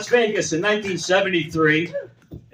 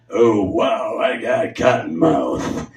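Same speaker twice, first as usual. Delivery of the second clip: much lower, slow spaced-out drawl; stoned George Carlin; 11 semitones lower, much slower than usual. Retold or imitated. Stoned Carlin opening his show.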